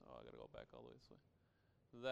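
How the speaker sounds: very low, soft mutter to oneself; quiet thinking-aloud aside. Real, not acted. Aside while finding his place.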